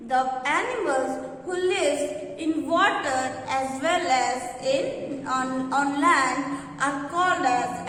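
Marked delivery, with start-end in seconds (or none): none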